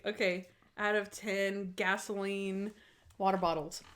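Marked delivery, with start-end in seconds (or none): none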